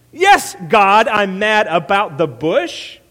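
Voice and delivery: very loud, high, drawn-out sarcasm; furious sarcastic outburst; Jonah. Acted. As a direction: shout it back, slow and exasperated. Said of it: "tone of sarcasm in both characters".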